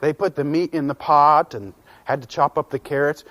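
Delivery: ordinary delivery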